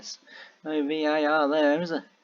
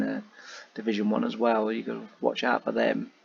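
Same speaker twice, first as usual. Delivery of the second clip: normal talking voice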